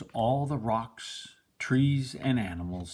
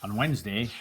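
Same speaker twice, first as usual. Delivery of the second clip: ordinary delivery, nothing marked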